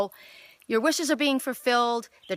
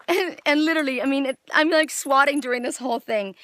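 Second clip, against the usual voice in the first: flustered laughing; 5 semitones higher, 5 dB louder than usual. Real, not acted. Laughing while swatting mosquitoes.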